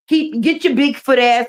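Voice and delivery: ordinary talk, nothing marked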